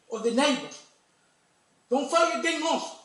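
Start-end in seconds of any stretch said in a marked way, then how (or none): 1.9-3.0 higher, louder plea with "fight" falling; pleading hard, higher and louder; urgent plea. Real, not acted. Speaker pleading with the commission.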